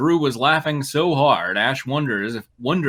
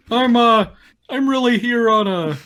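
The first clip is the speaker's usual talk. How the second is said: higher, louder, quick put-on Drew voice; ad-libbing as Drew; 10 semitones higher, 5 dB louder than usual. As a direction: riff as Drew, pitched way up and eager.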